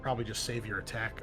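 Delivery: normal talking voice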